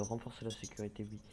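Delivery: ordinary talk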